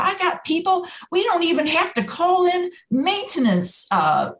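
high, drawling Southern plant manager; a Southern manager's twang, pitched well up. Retold or imitated. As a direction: imitate a folksy Southern boss. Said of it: "in his little Southern twang".

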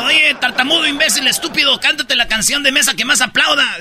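high mocking taunt; taunting bully. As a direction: sneer up high at him.

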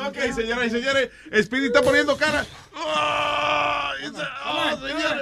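fast excited ringside call; lucha libre announcer. Acted. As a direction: hype the crowd, rapid-fire. Announcer calling the bout.

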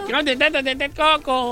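garbled mock crowd call; rapid, mushy parody shout-out; imitating el chino. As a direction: mimic a colleague's jumbled delivery. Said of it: "se da cuenta que yo no hablo así".

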